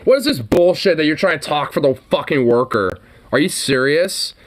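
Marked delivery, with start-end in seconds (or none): none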